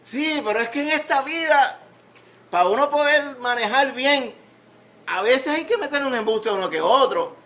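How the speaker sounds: high, loud put-on protest; mock objector. Preacher voicing someone who excuses small lies, mock-protesting. Voices an objector defending lying.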